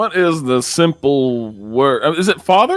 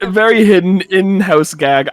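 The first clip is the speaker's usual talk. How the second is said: usual speaking voice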